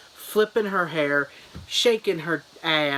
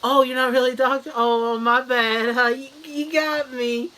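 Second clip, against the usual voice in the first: mock fooled victims; 8 semitones higher than usual. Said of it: "they're like".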